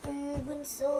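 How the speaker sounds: soft, half-asleep mumble; barely awake and quiet; sleepy. Acted. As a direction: answer drowsily from bed. Woken from sleep by younger sibling.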